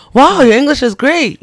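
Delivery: high, loud, slow gushing wow; over-the-top gush at a foreigner; patronizing praise. Retold or imitated. A local being patronizing about someone's English, overdone gushing. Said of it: "go out of my way to be like".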